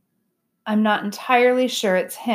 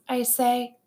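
usual speaking voice

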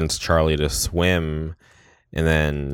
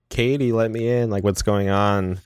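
higher, pleading through a door; desperate plea; 5 semitones higher than usual. Retold or imitated. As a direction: beg to be let in, worried. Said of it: "Charlie's, like, banging on the door".

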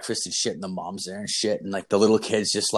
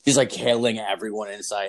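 ordinary talk

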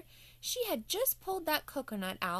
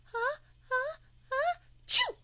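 huh huh huh, then chew; building sneeze; 5 dB louder than usual. Acted. Olivia hiding, sneezes again. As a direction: let the sneeze build and burst. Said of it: "Olivia goes".

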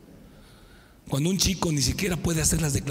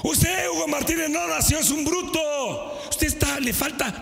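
mocking teacher; 9 semitones higher than usual. Teacher insulting Hugo as a brute. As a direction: sneer down at the student. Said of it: "¿sabes qué me decía ese desgraciado?".

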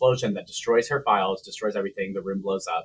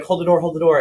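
louder, higher call to hold the door; calling out for the elevator to wait; urgent mock shout, raised pitch; retold panicked call; 6 semitones higher, 6 dB louder than usual. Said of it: "And he's like".

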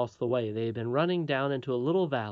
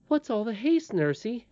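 lightly voiced, higher-pitched little princess; young princess; 9 semitones higher than usual. A narrator's reading. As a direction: ask as a puzzled child princess, lightly voiced. Princess questions hurrying nurse.

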